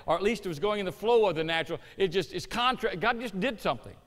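usual speaking voice

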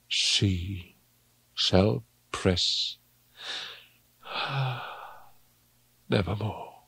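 soft, halting, with long pauses; quiet, slow mourning; hushed grief. Acted. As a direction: let it sink to a hush, breaking off to sigh. Grieving for lost Lenore, softly.

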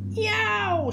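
high, drawn-out yelp; pained bellow. A narrator's reading. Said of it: "she bellowed".

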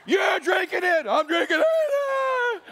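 very high, slow, loud shouted order; angry dad shout. Acted. Moses roaring at the camp, furious dad shout. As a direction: yell it like a furious dad with a popping vein. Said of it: "Moses just went dad".

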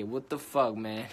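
ordinary talk

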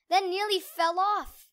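ordinary delivery

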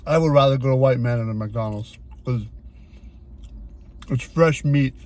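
eating between words; talking while chewing. Real, not acted. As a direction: mouth partly full of food. Seen 2: Takes repeated bites of the burger piece and chews while talking.

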